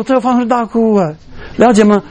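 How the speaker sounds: ordinary delivery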